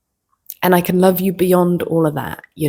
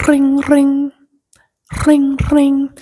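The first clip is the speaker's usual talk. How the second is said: mock phone ring; 9 semitones higher, 5 dB louder, much slower than usual. Imitating phone ringing.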